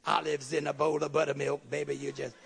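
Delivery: low, crooning mock pickup line; preacher imitates a man sweet-talking a woman, low and syrupy; smooth talker. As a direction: ham up a cheesy sweet-talker. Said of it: "Not that rapping stuff".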